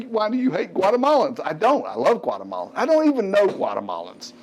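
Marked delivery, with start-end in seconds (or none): 0.0-1.4 raised, higher voice of an accusing viewer; lightly voiced indignant viewer asking why; mock angry emailer. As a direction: play the offended emailer, half-joking. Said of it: "Somebody's gonna email me and say".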